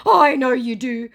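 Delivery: high, loud, slow put-on scammer voice; mock scammer voice. Scammer begging the narrator to listen, high slow mock voice. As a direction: plead slowly to be heard. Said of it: "he said".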